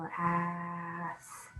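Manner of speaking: "Class" stretched slowly, each sound drawn out and soft. Shown as a demonstration. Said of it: "Sound it out".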